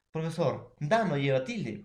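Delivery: usual speaking voice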